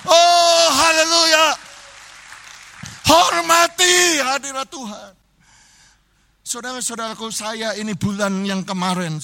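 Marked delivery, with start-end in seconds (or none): 0.0-1.4 loud chanting sermon climax; louder, sing-song Pentecostal preaching; full-force preaching. Real, not acted. 3.1-5.1 high, slow, loud charge to honour God's presence; slow ringing exhortation; solemn loud command. Real, not acted. Preacher commands reverence.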